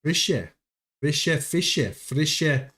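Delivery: "Fische" with a clear final e, FISH-eh; E at the end pronounced. Shown as a demonstration. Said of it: "So you've got to pronounce the E".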